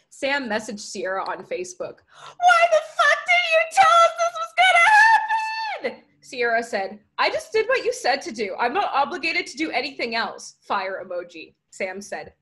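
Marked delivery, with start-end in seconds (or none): 2.5-6.0 screamed, very high and loud; furious high-pitched shout; outraged yell. Acted. Furious Facebook message, acted out. 7.2-10.6 raised, louder defensive reply; mildly indignant, higher-pitched comeback; defensive retort. Acted. Sierra answers the outraged message.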